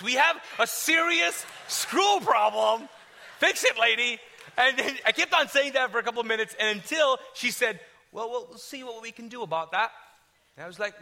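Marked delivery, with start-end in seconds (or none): 0.0-4.2 hammed-up raised-voice demand; mock exasperated shout. Acted escalating complaint to receptionist. 8.1-9.9 polite British brush-off; lightly put-on British accent; British receptionist. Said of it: "She's British".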